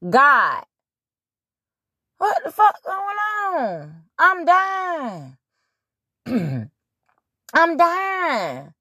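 crying, shouting up at the sky in mock agony; hammed-up dying Jesus. Jesus on the cross crying out to God, hammed-up wail. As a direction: wail it like a dying man calling God, high and strained. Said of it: "the only way I know possible".

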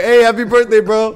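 breezy fake goodbye; mock cheery greeting. Mocking friend leaving with food.

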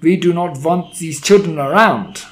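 imperious, loathing outburst; screamed. A narrator's reading. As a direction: rail against them in disgust. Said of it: "she screamed".

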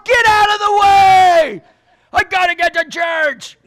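loud, slow, drawn-out shout; road-rage shout. Retold or imitated. Late driver shouts at neighborhood traffic, loud and slow. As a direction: bellow at the drivers ahead.

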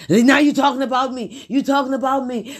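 jab the accusation back twice; huffy husband. Retold or imitated. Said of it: "he's huffing and puffing".

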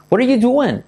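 high, falling "What are you doing" with "doing" as "du-en"; linked "What are you", then "du-en" for "doing"; doing like duende. Shown as a demonstration. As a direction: end on "doing" pronounced "DU-en". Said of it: "decir doing como duende".

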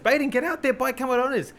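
high-pitched, urgent push from the wife. Retold or imitated. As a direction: hurry him out of bed. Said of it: "And she was like".

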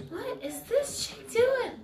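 baffled pupils. Retold or imitated.

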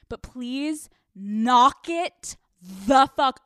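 "knock it" loud and drawn out; slow, loud punch on each word of "knock it the fuck"; emphatic order. Pretend. Host telling dog owners to stop, slow emphatic order.

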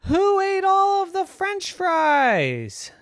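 高八度、慢而大声的小女孩质问; shocked little girl. A narrator's reading. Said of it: "looked down and said".

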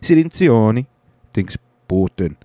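hammed-up Italian name; Italian accent. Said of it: "I'll do everybody's voice in their accent".

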